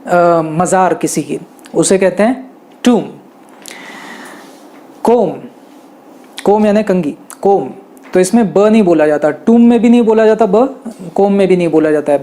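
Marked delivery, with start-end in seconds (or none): none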